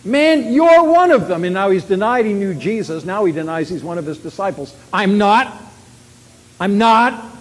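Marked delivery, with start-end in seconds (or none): 0.0-1.4 loud, high, drawn-out accusation; accusing outburst. Acted. Man bursts out at Peter, loud accusing outburst. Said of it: "a man bursts out". 4.9-7.3 loud, raised-pitch protest; emphatic denial. Acted. Peter denies he is a disciple, loud protest.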